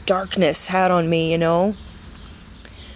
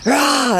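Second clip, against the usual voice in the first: mock angry roar; 8 semitones higher, 4 dB louder than usual. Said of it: "we're just like".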